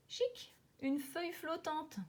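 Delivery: high, slow and pleased; delighted. A narrator's reading. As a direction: sound thrilled to find it. Frog delighted to find leaf.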